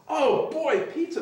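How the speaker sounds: high, eager cheer at the treat; retold excitement. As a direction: act out giddy food delight. Delighted reaction to free pizza.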